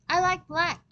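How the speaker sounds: put-on a small child digging in his heels; insisting. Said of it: "Peter insisted".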